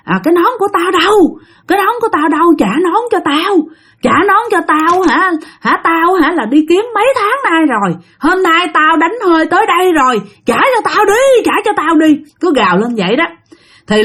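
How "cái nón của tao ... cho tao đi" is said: much higher, demanding ghost voice; imitating a possessed man's rant; possessed yelling. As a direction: scream at her for the hat. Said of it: "gào lên chửi con Tâm".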